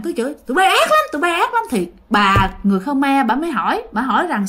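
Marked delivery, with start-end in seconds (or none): 0.5-1.6 high, sharp accusing cry; angry scolding. Retold or imitated. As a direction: keep cursing them in a raised pitch. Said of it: "cứ chửi các bạn". (giọng chửi rủa, cao gắt)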